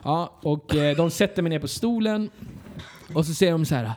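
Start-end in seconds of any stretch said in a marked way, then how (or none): none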